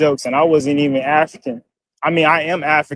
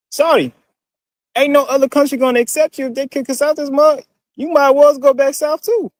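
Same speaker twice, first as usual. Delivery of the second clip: put-on Southern accent, pitched way up; fake Southern accent; 9 semitones higher than usual. Replying to a commenter in a fake Southern accent. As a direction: play it as an overdone Southern accent. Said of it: "fake-ass Southern accents".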